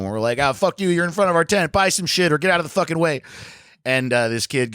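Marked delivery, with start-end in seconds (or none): 0.4-3.2 raised, fast retold telling-off; comic fake yell at teens blocking the tent; mock yelling at kids. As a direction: bark it at the kids in line, hammed up.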